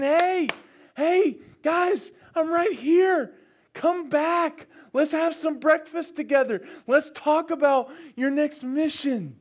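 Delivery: high, loud call across the water; calling out, lightly voiced. Jesus to the disciples on shore, high call.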